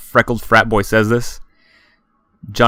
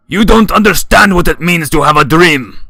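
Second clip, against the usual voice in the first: put-on loud, higher slurred drunk; booming drunken voice; 8 semitones higher, 8 dB louder than usual. A narrator's reading. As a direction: boom it out, sloppy drunk. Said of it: "his booming, drunken voice".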